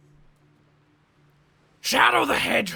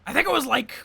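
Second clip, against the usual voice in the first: normal talking voice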